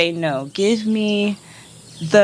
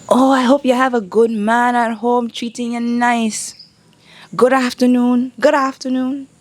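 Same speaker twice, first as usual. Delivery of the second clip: higher, pushy catcaller voice; mock catcaller; 5 semitones higher than usual. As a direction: sneer like an offended street harasser. Voicing the men's catcalls.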